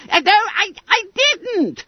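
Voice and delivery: very high, loud squealed denial; alarmed roar. A narrator's reading. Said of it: "roared Bunter in alarm".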